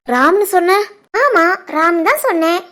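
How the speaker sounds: usual speaking voice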